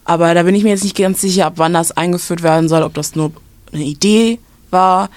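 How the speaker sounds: usual speaking voice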